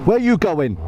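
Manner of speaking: normal talking voice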